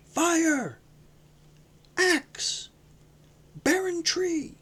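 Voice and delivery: high, wild preacher's cry; a wild hoarse voice crying in the wilderness; wild prophet's cry. A narrator's reading. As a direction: cry out like a raving prophet in the desert. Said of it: "a wild, hoarse voice".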